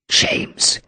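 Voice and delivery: breathy, half-voiced groan of the name; a soft pained groan. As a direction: let "James" sink out as a pained groan. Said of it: "he groaned".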